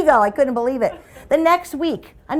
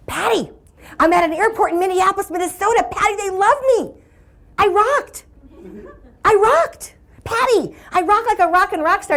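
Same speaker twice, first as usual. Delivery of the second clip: high, giddy phone boast; hammed-up elated call; mock excited bragging; 9 semitones higher than usual. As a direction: crow to your sister about a triumph.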